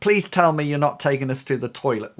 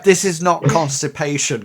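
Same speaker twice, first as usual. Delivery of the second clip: ordinary delivery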